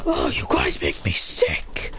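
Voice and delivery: slow, low, rough-voiced scorn; mock beast disgust. Tailed beast scolding the crowd, low growled anger. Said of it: "Tailbeasts are pissed".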